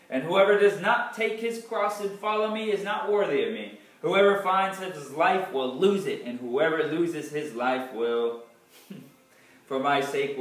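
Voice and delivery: ordinary talk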